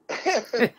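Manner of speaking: a sudden high whoop of a laugh; high burst of laughter. Real, not acted. Telling the host the story, bursts out laughing. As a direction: crack up at the memory.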